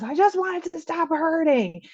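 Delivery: high-pitched mock child's wail; losing her mind, imitated. Mother imitating a distraught child, hammed-up wail. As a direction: whine it like an inconsolable child. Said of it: "she's still just losing her mind".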